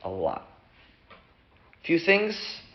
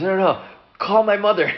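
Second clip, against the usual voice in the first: high, emphatic protest; urgent insistence; 8 semitones higher, 3 dB louder than usual. Acted. Accused namesake demands they call his mother, urgent insistence.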